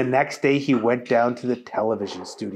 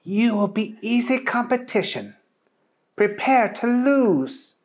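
put-on high, haughty Italian accent; 9 semitones higher than usual. A narrator's reading. Francesca taunts Booger in an Italian accent. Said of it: "very strange accents".

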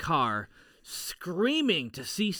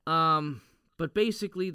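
usual speaking voice

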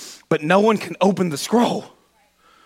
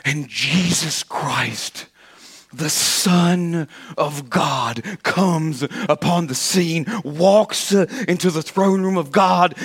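slow reverent; much slower than usual. Acted. Preacher slowly unveiling Christ to the church. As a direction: linger on each phrase.

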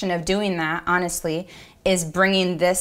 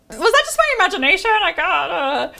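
doubting inner voice; 11 semitones higher, 4 dB louder than usual. Retold or imitated. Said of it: "it feels like".

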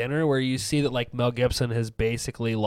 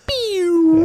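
pew sound effect; 8 dB louder than usual. Retold or imitated. Mimicking alien ejaculation noise.

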